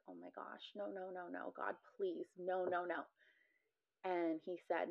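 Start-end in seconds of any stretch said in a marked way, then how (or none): 0.1-3.0 soft, barely voiced plea; hushed pleading. Patient recalling her plea to God, hushed and pleading. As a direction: beg under your breath, very quietly.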